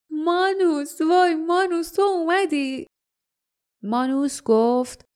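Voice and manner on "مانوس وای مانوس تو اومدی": high, overjoyed call of a friend's name; joyful cry. A narrator's reading. Leif greets the Ralad man with joyful cry. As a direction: cry out his name in delighted relief. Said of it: "و فریاد زد".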